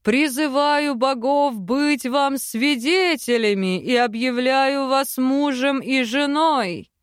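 lightly voiced solemn chief. Chief pronounces marriage.

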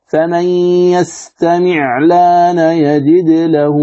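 level tajweed chant with vowelless ع in "يستمع"; wrong sukun. Shown as a demonstration. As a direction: chant "يستمع الآن" slowly as yastami'-laana, 'ayn without a vowel. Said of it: "فيرجع حركة العين التي هي السكون".

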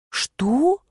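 bewildered; exhaled disbelief. A narrator's reading. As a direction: breathe it out in astonishment. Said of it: "выдохнула наконец".

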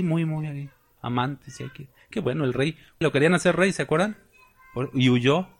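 ordinary delivery

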